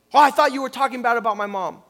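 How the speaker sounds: hammed-up indignant outburst, loud and fast; mock angry retort. Pastor mimics an offended man, mock angry loud retort. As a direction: snap back like someone misheard an insult. Acting out a touchy listener.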